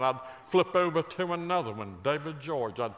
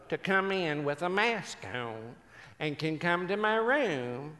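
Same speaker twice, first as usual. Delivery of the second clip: imitated elderly mother; 3 semitones higher than usual. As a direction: draw out each phrase as a proud old mother. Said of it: "She said".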